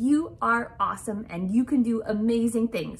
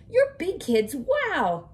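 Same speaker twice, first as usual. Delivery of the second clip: bright, cheery praise; exclaiming parents; 5 semitones higher, 4 dB louder, much slower than usual. Acted. Parents praising their kids at the school door, lightly excited. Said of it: "Their parents exclaimed".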